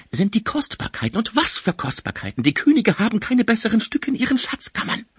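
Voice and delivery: high, gloating delight; greedy excitement. A narrator's reading. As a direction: rave over the treasures. Magus raves over the treasures.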